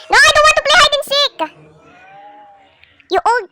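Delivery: high, loud protest; mock protest. Real, not acted. Gamer refusing Grandpa's hide and seek, high protest. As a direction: whine back at Grandpa.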